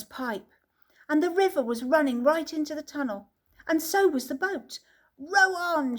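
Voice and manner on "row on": shouting. A narrator's reading. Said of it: "shouted Mister Wonka".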